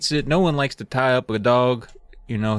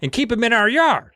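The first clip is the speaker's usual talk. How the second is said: angry dad; 8 semitones higher than usual. Retold or imitated. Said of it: "He's like".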